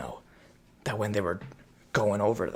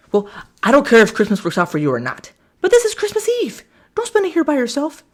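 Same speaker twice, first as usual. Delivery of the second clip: mock high pleading; 13 semitones higher, 5 dB louder than usual. Girl voiced in the retelling.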